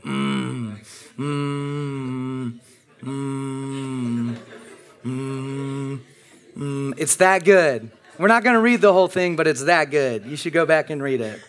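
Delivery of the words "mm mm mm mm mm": long approving hum. Retold or imitated. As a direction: hum along the whole time. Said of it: "They'd just be like".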